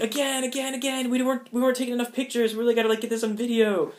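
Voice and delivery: high, quick, excited insistence; eager demand. Retold or imitated. Onlookers begging the couple to drink again, high and fast. Crowd demanding another round for pictures.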